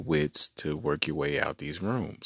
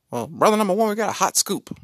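put-on higher, louder old newsroom editor; 1940s newsroom editor; 12 semitones higher, 5 dB louder, faster than usual. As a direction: play the fast-talking 1940s editor. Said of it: "nineteen forties, like, newsroom".

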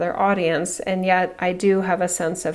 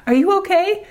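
high, louder "Are you okay?"; concerned mom; 12 semitones higher, 6 dB louder than usual. Retold or imitated. Mom calling from the minivan to the bullied boy, high concerned call. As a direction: check on the beaten kid gently but clearly. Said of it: "And I'm like".

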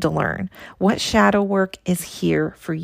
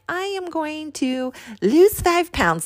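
higher-pitched imitation of an eager resolution; mock goal declaration; 8 semitones higher than usual. Coach mimicking herself listing too many intentions, mock declaration. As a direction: announce a goal in a put-on higher voice.

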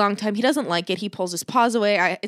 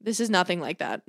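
ordinary talk, nothing marked

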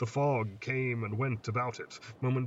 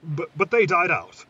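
higher and much louder, stumbling on but; frightened protest; 5 semitones higher, 9 dB louder than usual. Acted. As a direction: cry out in disbelief. Boy protests monster should be extinct.

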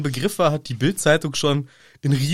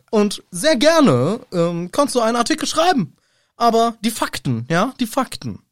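hoch, langsam, affektiert; nervige Stimme; 8 semitones higher, 4 dB louder than usual. Pretend. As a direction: weiter nervig als Makellos. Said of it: "Ich rede jetzt sehr nervig". (Rita nervig und hoch)

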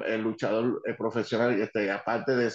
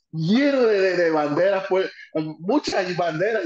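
excited; 4 semitones higher, 5 dB louder, slower than usual. Real, not acted. Describing the packed arena.

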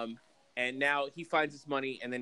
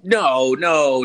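loud denial; 12 dB louder than usual. Real, not acted.

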